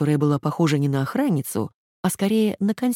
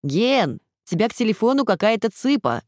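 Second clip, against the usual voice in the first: yelling across the room; 6 semitones higher than usual. A narrator's reading. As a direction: shout it to someone far off. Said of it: "крикнул через всю комнату".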